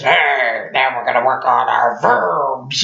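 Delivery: lightly growled butch nun teacher; mock gruff nun addressing her class. Imitating the butch English nun.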